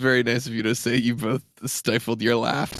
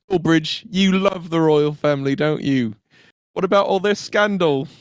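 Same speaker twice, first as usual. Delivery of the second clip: higher-pitched British needling; teasing stable boy; 5 semitones higher than usual. Pretend. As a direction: goad a workmate in a put-on British accent. Stable boy teases Dullbridge.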